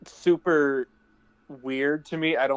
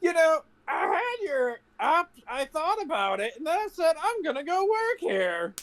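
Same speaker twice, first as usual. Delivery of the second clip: much higher, goofy voice; mock character voice; 11 semitones higher than usual. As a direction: pitch up like a cartoon dork. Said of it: "he's just like".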